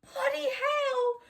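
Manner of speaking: very high, drawn-out mock whine; whining. A narrator's reading. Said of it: "he whined".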